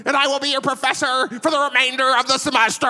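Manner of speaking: mock squeaky, high-pitched little lecturer; high squeaky professor. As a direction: squeak it out like a scrawny, odd professor. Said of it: "he had this high squeaky voice".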